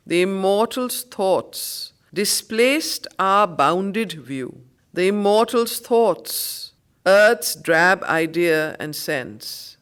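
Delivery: loud, slow declaiming of verse; poem recitation. Acted. Teacher declaiming Savitri to the class, loud and slow. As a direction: draw out each phrase grandly.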